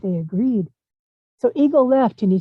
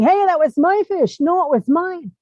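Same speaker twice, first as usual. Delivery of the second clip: higher, louder squabbling yell; two rivals shouting over the fish; 8 semitones higher, 3 dB louder than usual. A narrator's reading. Kingfisher and Otter yelling over the fish, angry. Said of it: "They started yelling".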